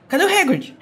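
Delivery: high-pitched blunt mock question; imitating an impatient pupil; abrupt demand. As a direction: demand an answer, pitched well up. Acts Harry's blunt demand to teacher.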